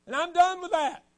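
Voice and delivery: high, loud declaration; mock loud vow. Teen ending his testimony to the youth group, loud vow. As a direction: slam the door on the old life.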